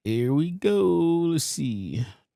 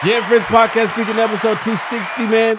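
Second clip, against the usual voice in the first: louder, pumped welcome; hyped show intro; 6 semitones higher, 6 dB louder than usual. Real, not acted. Host welcoming listeners to the episode, loud hype. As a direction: hype up the crowd.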